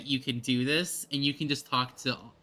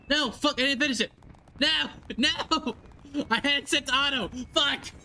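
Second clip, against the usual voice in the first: frustrated outburst; 10 semitones higher than usual. Real, not acted. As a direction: yelp in dismay at the skipped line. Dialogue skipped on auto, frustrated.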